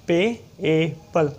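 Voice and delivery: पेएबल said slowly with पे and ए held apart; slow sounded-out payable. Shown as a demonstration. Teacher sounding out पेएबल after naming the ए sound, slow. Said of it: "ए की sound आई".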